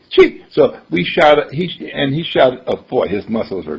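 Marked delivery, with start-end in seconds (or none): 0.1-0.4 sharp high-pitched "chee"; high whizzing brick sound. Retold or imitated. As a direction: imitate a brick shooting upward. Imitates brick shooting upward.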